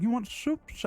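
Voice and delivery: a hint of a creepy old man, S's drawn out; lightly voiced old man. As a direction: stretch the S in soups a little. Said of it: "the elongated S's".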